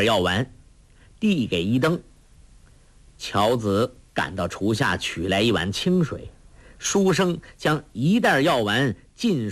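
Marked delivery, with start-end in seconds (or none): none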